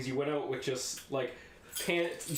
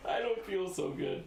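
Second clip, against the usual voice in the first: slow, breathy mock queasy laugh; laughing mock sick; 6 semitones higher, much slower than usual. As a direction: giggle through feeling sick.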